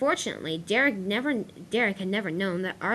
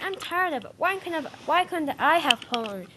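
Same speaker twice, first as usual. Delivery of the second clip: higher-pitched storybook grumble; fed-up complaint; 9 semitones higher than usual. A narrator's reading. Arthur venting to his driver about his job, fed up. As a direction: voice lifted in acted exasperation. Acted complaint of furious engine.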